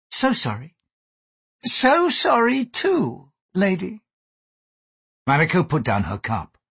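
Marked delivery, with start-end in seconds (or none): 0.1-0.8 brief, understated polite regret; polite apology. Acted. Mariko politely refuses Gyoko. 1.6-4.0 high, slow, drawn-out apology; pointed echo. Acted. Gyoko echoes the apology back.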